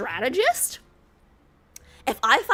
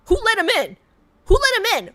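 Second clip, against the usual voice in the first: higher, louder repeated demand; outraged yell; 11 semitones higher, 7 dB louder than usual. Acted. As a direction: call out for the guards. Queen yells for guards.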